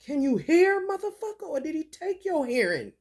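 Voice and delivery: high-pitched mock yell at a starer. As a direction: yell it up high, fed up and baiting him. Yelling at staring man.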